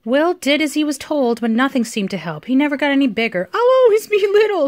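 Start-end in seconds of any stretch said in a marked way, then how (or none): 3.5-4.7 high, mock-crying little giant; tearful wail. A narrator's reading. Small giant upset at staying little, tearful wail. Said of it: "He started to cry".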